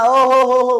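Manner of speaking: high, loud, gushing delight; delighted exclamation. Real, not acted. Teacher gushing to students over a lovely word, loud and high. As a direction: burst out in joy at the word.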